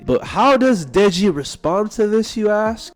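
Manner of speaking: high, sarcastic question to the viewers; mock rhetorical question. Real, not acted.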